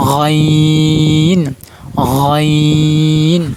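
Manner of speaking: গইন drawn out from the back of the throat, loud and long; like gargling water, a long loud গইন; guttural ghain demo. Shown as a demonstration. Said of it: "এরকম".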